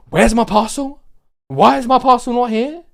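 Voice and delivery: high, insistent mock complaint; demanding customer. Customer chasing a parcel on the phone, high demanding complaint.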